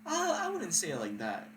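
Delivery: high-pitched defensive girl impression; imitating the hedging date. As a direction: start way up in her voice, then ease down. Voicing the date's hedging.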